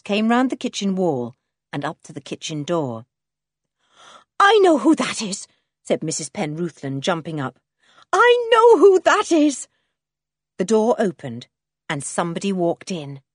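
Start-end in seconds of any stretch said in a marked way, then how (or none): 4.4-5.5 loud, higher-pitched exclamation; sudden eager cry; excited recognition. A narrator's reading. Wife jumps up hearing husband arrive. 8.1-9.7 very high, loud, drawn-out exclamation; high-pitched, stretched, elated shout; overjoyed cry. A narrator's reading. Wife repeats it, more excited.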